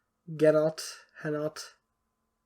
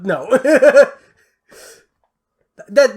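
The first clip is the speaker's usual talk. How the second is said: ordinary delivery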